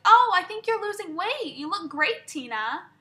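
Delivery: high, loud mock-gushing with a negative edge; backhanded grandma compliment. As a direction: mimic grandma turning praise into a dig. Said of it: "says it in a way that is completely negative".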